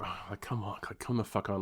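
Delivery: under the breath, fed up; muttered impatience. Retold or imitated. Stuck waiting at the window, muttering fed up. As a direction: grumble it to yourself low.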